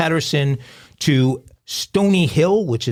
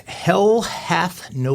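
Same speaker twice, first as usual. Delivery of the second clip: slow; 4 semitones higher, much slower than usual. Real, not acted. Drawn out famous saying.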